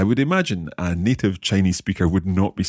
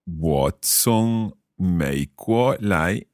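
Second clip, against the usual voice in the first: very slow, syllable by syllable; slow learner's repeat; much slower than usual. Shown as a demonstration. Learner repeating the teacher's Chinese phrase, very slowly. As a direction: draw out each tone carefully. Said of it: "I'll try repeating them".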